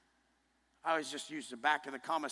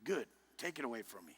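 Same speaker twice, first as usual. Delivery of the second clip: quiet defiance; 5 semitones lower, 8 dB quieter than usual. Retold or imitated. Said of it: "The way I always lived was".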